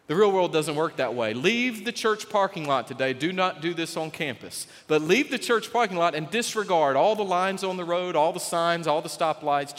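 usual speaking voice

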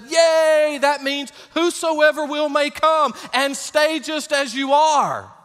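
mock cheering. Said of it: "some churches have said".

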